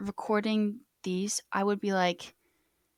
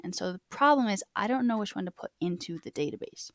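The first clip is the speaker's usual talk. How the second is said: ordinary talk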